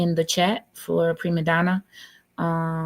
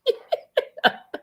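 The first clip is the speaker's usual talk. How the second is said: high laugh. Real, not acted. Laughs after thanking guest.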